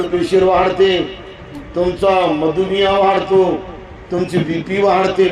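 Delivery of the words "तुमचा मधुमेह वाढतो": ordinary delivery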